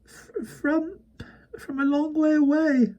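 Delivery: put-on high, stammering, miserable boy; miserably. A narrator's reading. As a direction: stumble over it in misery. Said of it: "Barney said miserably".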